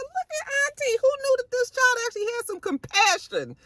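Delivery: very high, teasing voice; mock amazement. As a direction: tease the aunt in a squeaky, amazed tone. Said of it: "I said".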